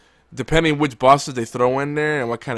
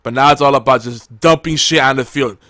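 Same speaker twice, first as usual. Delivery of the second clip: ordinary talk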